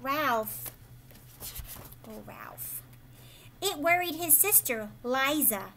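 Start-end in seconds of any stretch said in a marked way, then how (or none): 2.1-2.8 soft whisper. Acted. Sighing at Ralph's mischief.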